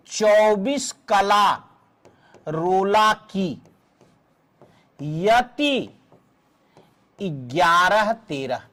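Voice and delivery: slow, phrase by phrase with long pauses; slow dictation. Shown as a demonstration. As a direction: dictate the formula for students to write. Teacher dictates the formula phrase by phrase. (सूत्र धीरे-धीरे, रुक-रुककर लिखवाते हुए)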